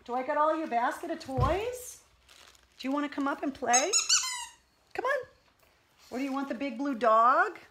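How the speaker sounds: high sing-song pet voice. Real, not acted. Owner coaxing her puppy up to play in high baby talk. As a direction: coo at the puppy to coax her.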